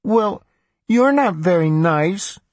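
high, slow sing-song pout; little girl sing-song. A narrator's reading. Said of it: "in a little girl sing-song tone".

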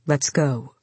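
low, quiet and bored; a bored warrior, sounding low; deep bored voice. A narrator's reading. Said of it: "His deep, somewhat bored voice".

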